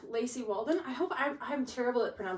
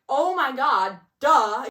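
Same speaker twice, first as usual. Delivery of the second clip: louder mock self-scold; realising duh; 3 semitones higher, 9 dB louder, much slower than usual. Reader looking back on the clues, loud mock realisation. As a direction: slap your forehead with your voice. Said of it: "you're like".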